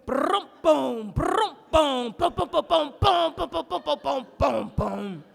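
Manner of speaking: loud rolled brums and popping booms; rhythmic fart imitation. Comic proves farts have rhythm, drummed fart noises. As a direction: beat out farts like a conga solo. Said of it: "There's a whole lot of rhythm".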